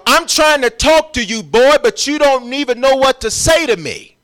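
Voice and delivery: high, loud, preaching God's words; God's voice preached. Retold or imitated. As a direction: call out as the Lord. God voiced in sermon.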